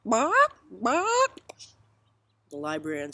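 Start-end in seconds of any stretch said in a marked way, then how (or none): 0.0-1.4 two loud, high rising clucks; high-pitched "bawk, bawk"; chicken squawks. Acted. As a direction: cluck twice like an insistent hen.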